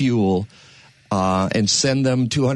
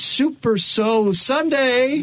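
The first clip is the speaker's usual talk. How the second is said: drawn-out announcement; 12 semitones higher, much slower than usual. Acted. Host announcing the show's theme.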